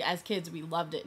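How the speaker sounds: ordinary talk, nothing marked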